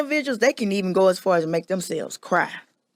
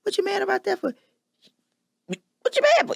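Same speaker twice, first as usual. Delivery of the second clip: much higher mock of a scornful partner; mocking dismissive partner; 13 semitones higher than usual. Mimicking her partner telling her not to be mad, high mocking voice. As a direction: pitch it way up, ridiculing him. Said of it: "tell me how to feel".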